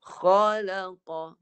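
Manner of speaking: "Khalaqa" stretched very slowly, kha from the top of the throat; modelling the word for the pupil; heavy kha demo. Shown as a demonstration. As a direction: press the kha at the start of the throat. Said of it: "Ha, sentuh".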